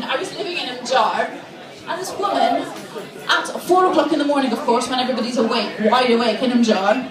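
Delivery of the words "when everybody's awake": normal talking voice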